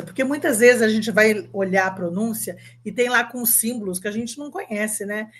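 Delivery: usual speaking voice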